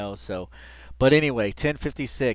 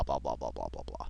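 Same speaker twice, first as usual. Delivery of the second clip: blah blah; 13 semitones lower, 12 dB quieter than usual. Retold or imitated. Mimicking rambling.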